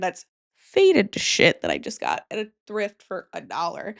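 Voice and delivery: ordinary talk, nothing marked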